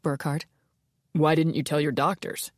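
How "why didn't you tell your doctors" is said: skeptical detective. Acted. Burkhart questioning Ilona.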